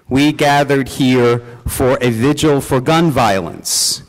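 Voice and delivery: ordinary delivery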